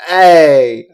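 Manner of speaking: loud, brash Brooklyn accent impression; a loud mock Brooklyn rant about skipping the treasure hunt. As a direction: yell it like a hammed-up Brooklyn tough guy. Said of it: "Brooklyn would be good too".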